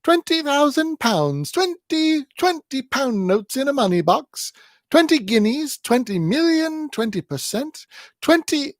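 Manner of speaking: put-on a half-asleep old wife blurting out sums; high repetitive jabbering; jabbering old woman. A narrator's reading. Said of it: "is instantly aroused and jabbers".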